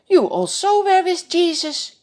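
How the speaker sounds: high, loud pointed accusation; girl's voice pinning Peter down; accusing servant girl. Acted. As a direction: catch him out by the fire. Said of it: "She looks closely at him and says".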